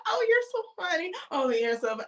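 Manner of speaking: high, fast, gushing mockery; mock flirty gush.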